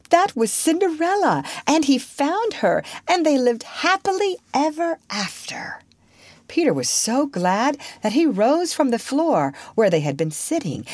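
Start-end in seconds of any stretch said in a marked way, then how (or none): 0.1-5.8 high-pitched, excited outburst; thrilled girl blurting out the story's ending. A narrator's reading. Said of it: "said Wendy excitedly".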